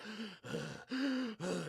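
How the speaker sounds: held-in gasp of nausea; heavy gasp. Retold or imitated. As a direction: gasp like you're fighting not to puke. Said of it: "I'm like, breathing heavy".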